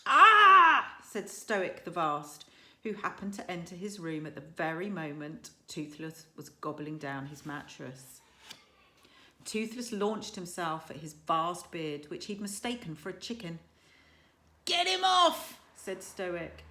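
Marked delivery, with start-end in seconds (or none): 0.1-1.0 much higher, far louder shocked "ah"; startled cry. A narrator's reading. Stoick cries out. 14.6-15.6 much higher, louder frantic shout; father shouting with a dragon on his beard; alarmed shout. A narrator's reading. Stoick in distress.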